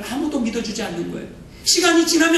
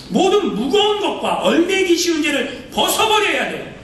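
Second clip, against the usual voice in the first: full-force preaching; 6 semitones higher than usual. Acted. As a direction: drive the call home like a revival preacher. (힘차게 외치는 설교)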